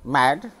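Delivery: mad said with short flat a. Shown as a demonstration. Teacher spelling then saying mad. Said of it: "एमएडी".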